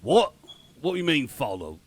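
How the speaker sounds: startled Cockney guard, loud at first; sharp Cockney double-take; shitty Cockney accent. Acted. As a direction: question them in bad Cockney. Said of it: "my shitty Cockney accent".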